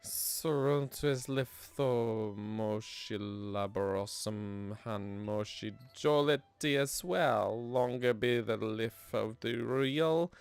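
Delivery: put-on slow, solemn old-text reading; archaic reading. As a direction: read it like an ancient chronicle. Reads archaic tablet text.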